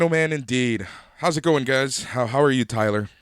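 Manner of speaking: usual speaking voice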